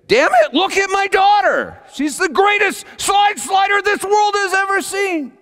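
high, loud outraged father's shout; furious rage. Retold or imitated. Father imagining confronting the lady who ignores his daughter, furious shout. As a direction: explode in indignant fatherly fury. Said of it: "furious with rage".

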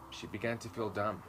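usual speaking voice

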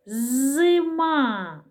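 "Зима" drawn out, lingering on И; slow teaching "Зима" with a long И; sounding out. Shown as a demonstration. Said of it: "Звук И".

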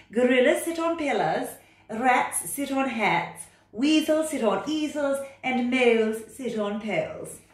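loud, high, bossy cat voice. Acted. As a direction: order the frog about like a know-it-all cat. Cat character.

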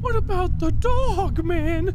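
high squeaky voice. Retold or imitated. High squeaky repeat of line.

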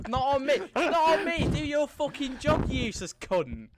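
high, loud shouting; heated outburst, dropping in pitch at the end; angry berating. Real, not acted. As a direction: yell furiously at your players. Berating players for errors.

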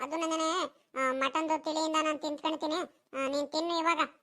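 put-on high child character voice; reluctant grandson. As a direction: play a boy turning down food from grandpa. Grandson refuses the food.

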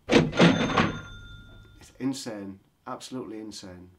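insane stretched out loud and slow; loud drawn-out exasperation. Real, not acted. Complaining about Swiss prices, loud drawn-out exasperation. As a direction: let the outrage drag out.

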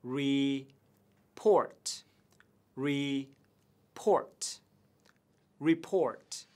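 "report" with PORT stressed; stress on second syllable. Shown as a demonstration. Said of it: "nhấn trọng âm vào âm tiết thứ hai". (nhấn vào âm "port" của "report")

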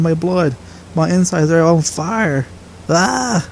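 high, pained begging, voice straining; agonized pleading. Acted. Apprentice in agony begging master to stop. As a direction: plead through burning pain.

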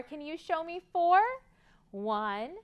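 plain reading voice

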